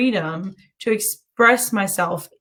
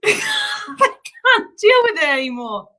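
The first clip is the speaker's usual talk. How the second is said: real laughter breaking into the words; laughing; 12 semitones higher than usual. Real, not acted. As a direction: struggle to talk through helpless giggles. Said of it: "I can't stop laughing about it".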